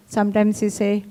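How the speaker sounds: ordinary talk, nothing marked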